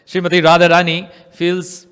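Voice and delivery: usual speaking voice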